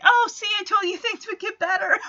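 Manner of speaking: high, quick and laughing; laughing Kimmy quote. Retold or imitated. Kimmy hugging Ramona, said high with a laugh. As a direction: chuckle through a cheery reassurance. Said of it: "Kimmy hugs her, like".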